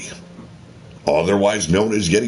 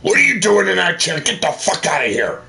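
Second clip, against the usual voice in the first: angry; 7 semitones higher, faster than usual. Acted. Throws intruder out of chair.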